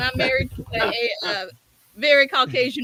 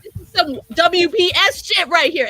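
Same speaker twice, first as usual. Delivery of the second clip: loud, higher mock rant; retold screaming; 7 semitones higher, 6 dB louder than usual. Wife berating husband in Publix, retold screaming. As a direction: re-enact yelling at your husband in a store. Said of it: "I'm screaming this at him".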